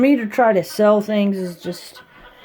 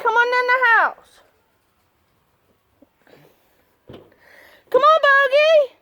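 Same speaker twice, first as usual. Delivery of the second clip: much higher, cooing voice for the dogs; doggy talk; 4 dB louder than usual. Acted. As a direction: coo at the dogs like babies. Calling dogs in pet voice.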